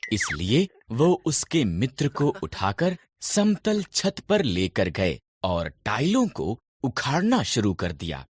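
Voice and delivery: ordinary delivery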